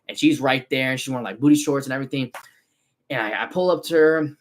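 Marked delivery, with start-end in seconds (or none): none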